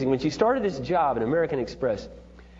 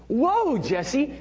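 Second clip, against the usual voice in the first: high, slow, drawn-out whoa; father stunned by his daughter's harmony, high and drawn out; amazed; 11 semitones higher, much slower than usual. Retold or imitated. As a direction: marvel at her singing.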